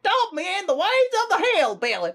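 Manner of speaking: hillbilly impression. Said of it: "hillbilly impression".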